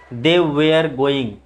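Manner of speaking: "were" as ve-ar with sounded r; wrong pronunciation. Shown as a demonstration. Teacher showing a common error, "were" said as वेयर. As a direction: slowly make "were" rhyme with wear. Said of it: "but गलत है".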